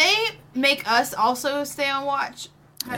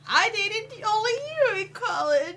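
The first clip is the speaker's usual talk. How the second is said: mock hurt; 7 semitones higher than usual. Mock hurt at friend's joke.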